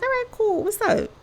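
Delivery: answering a friend's call, high and chirpy; relieved and breezy. Retold or imitated. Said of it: "I was like".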